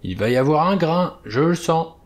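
put-on irritated old sailor. A narrator's reading. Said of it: "Irrité, le vieil homme insista".

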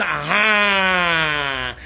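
long, falling "aha"; mock drawn-out exclamation. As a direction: stretch out a mocking crowd reaction, sliding down. Quoting crowd's drawn-out reaction.

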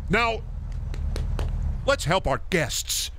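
retail manager voice. Retold or imitated. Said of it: "that's retail manager slime".

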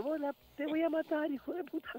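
high, soft mock threat; amenaza en broma. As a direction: threaten in a squeezed high voice, half laughing. Victim's retold threat after prank. (amenaza aguda, en broma)

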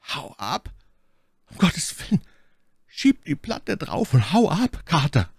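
high, desperate cry of panic; pitiful cry. A narrator's reading. Warren warning Carter over the telephone, pitiful cry. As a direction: cry out in panic, voice pitched right up. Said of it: "erklang ein kläglicher Schrei von Warren".

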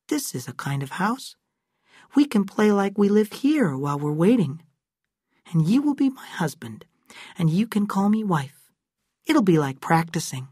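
a hint of a bossy girl planning a game; girl Abra playing house.

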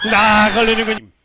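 loud, slow match call; drawn-out exclamation. Real, not acted. Commentator reacting to another netted ball, loud drawn-out call. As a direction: stretch the cry out at full volume.

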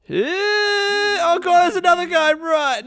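high-pitched alarmed shriek; panicked yell. Real, not acted. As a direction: yelp in alarm and flee. Startled by another enemy in game.

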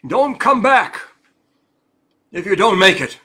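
loud, hard warning to a driver; mock stern order shouted. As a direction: threaten him with the consequences. Boss sending Keith off, threatening.

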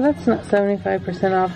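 ordinary delivery

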